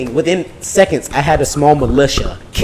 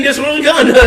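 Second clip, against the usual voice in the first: mock cop; 10 semitones higher than usual. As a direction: announce the charge like a gleeful cop. Mock cop arrest chant.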